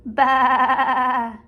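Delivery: loud bleating baa; shouted sheep bleat. Shown as a demonstration. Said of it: "Now this animal says".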